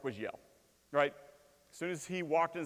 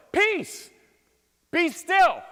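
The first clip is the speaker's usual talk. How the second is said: much higher, louder commanding shout; 13 dB louder, much slower than usual. Shown as a demonstration. Preacher showing Jesus's real tone to the sea, commanding shout. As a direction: bark it at the storm. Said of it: "It's more like".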